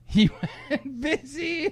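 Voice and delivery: words broken by laughter; laughing. Real, not acted. Laughing at the busy signal.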